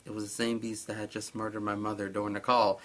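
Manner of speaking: ordinary talk, nothing marked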